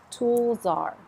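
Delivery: Tool czar, stress on Tool, soft z joined to czar; linked soft z. Shown as a demonstration. Teacher linking tool czar for students, stress on tool. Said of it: "definitely not the stress syllable".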